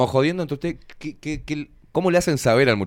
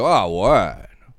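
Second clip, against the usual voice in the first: long drawn-out appreciative "ah"; admiring man; 4 semitones lower, much slower than usual. Acted. Man reacting to a passing woman, drawn-out admiration. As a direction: stretch it out, impressed. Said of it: "El hombre dice".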